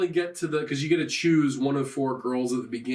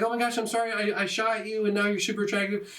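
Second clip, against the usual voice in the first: higher, gushing girl impression; apologetic cupid girl; 5 semitones higher, faster than usual. Cupid girl apologises for shooting him, mock apologetic. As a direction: imitate a girl apologising, pitched up.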